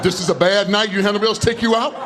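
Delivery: ordinary talk, nothing marked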